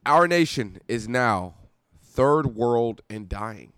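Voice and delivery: slow, weighty read aloud; slow dramatic reading. Pretend. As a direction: read it like a grim proclamation. Reading Trump letter dramatically.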